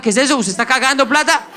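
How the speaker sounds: high-pitched mock outrage; indignant mother. Colombian mom questioning son's therapy bill, mock indignation. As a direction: imitate a mom appalled at the waste.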